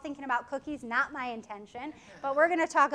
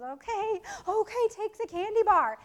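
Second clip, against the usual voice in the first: worn-out mom; 7 semitones higher than usual. Retold or imitated. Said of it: "the mom goes".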